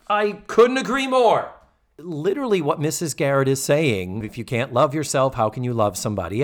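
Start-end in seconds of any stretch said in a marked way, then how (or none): 0.1-1.5 high-pitched, wholehearted endorsement; exclaimed agreement; emphatic agreement. Real, not acted.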